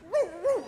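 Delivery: high imitated dog bark; Lassie. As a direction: yelp twice like an alert dog. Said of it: "What's wrong, Lassie?".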